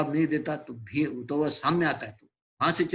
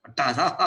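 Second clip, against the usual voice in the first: booming laugh. Acted. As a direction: laugh big, like a strongman showing off. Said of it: "हंसता है".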